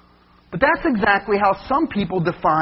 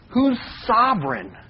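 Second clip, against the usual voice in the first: normal talking voice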